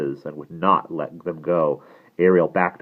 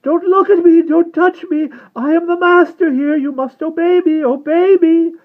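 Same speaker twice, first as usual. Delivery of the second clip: high, hysterical commanding screams; frantic shriek trailing into a wail; 13 dB louder than usual. A narrator's reading. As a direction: shriek orders at full voice. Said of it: "shrieked the vampire".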